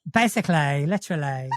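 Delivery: Essex accent, "Basically" and "literally" ending in a long "-leh-ee". Shown as a demonstration. Demoing Essex endings for the co-host. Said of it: "lean on the words that end in L Y".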